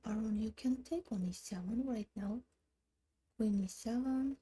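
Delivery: usual speaking voice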